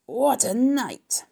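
drawn-out, swooping, husky wail; husky exclamation. A narrator's reading. Rat back from a night of feasting, husky and drawn out. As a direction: stretch it high and slow, husky. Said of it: "he repeated hoarsely".

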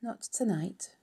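whispered, soft and tired; low voice. Acted. Charlotte refuses Wilbur softly in a tired whisper. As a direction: breathe it out, too weary to sing. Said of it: "she said in a low voice".

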